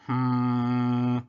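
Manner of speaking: long droning hum on one note; robotic snore. A narrator's reading. Robot falls asleep on the blanket, droning snore. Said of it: "snored Robot".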